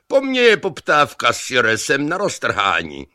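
loud, high bragging yell; boastful shout. A narrator's reading. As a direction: brag over the water. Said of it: "křičeli na sebe".

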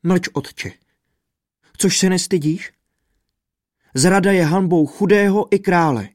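rozhořčeně okřiknout; scolding daughter. A narrator's reading. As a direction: cut her father off in anger. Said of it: "sama ho okřikla".

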